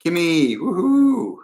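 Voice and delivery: high, loud whoop of joy; excited whoop. Real, not acted. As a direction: cheer the name like a fan. Cheering a viewer's name in chat.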